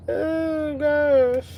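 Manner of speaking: high squealed "oh gosh"; high giggly embarrassment; embarrassed. Real, not acted. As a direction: squeal it bashfully. Embarrassed on camera.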